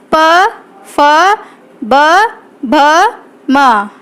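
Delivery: each lip sound alone, with a pause after it; rounded lips on प, फ, ब, भ, म; labial letter demo. Shown as a demonstration. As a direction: sound out प, फ, ब, भ, म one at a time. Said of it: "होंठ होते हैं वो राउंड में आ जाते हैं".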